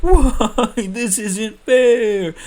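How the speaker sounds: high, loud, slow wailing complaint; whiny protest. Retold or imitated. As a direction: stretch it out like a sulking teenager. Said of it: "And Anakin is like".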